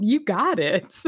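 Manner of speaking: slow cheesy grinning voice; mock grinning henchman; big grin delivery. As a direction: say it through a big old grin. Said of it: "big old grin".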